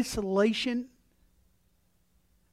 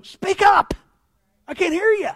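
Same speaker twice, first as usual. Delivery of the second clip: much higher, louder demand; mad yell; 8 semitones higher, 7 dB louder than usual. Retold or imitated. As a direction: shout it impatiently. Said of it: "when you're mad is go".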